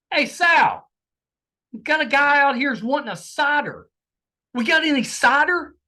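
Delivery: high, loud attempt at a New York bartender's accent. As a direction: call back to the kitchen in a New York accent. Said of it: "in a very New York accent".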